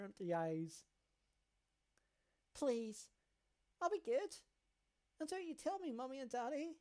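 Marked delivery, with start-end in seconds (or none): 2.5-6.8 put-on high child voice scolding big brother; a small sister threatening to tell Mommy; little girl tattling. Delirious girl begging family.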